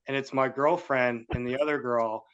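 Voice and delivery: ordinary delivery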